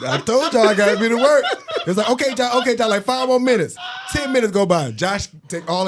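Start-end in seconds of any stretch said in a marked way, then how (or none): 0.1-1.4 higher, louder mock complaint; Josh. Friend demanding the bathroom before work, high imitation. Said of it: "Josh has always talked like this". 2.1-3.8 high, hurried-sounding put-off; harried stalling. Retold or imitated. Family answering through the bathroom door, harried.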